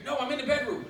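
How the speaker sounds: imitating a woman's urgent call. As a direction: call it out loudly to someone across the house. Woman calls from another room.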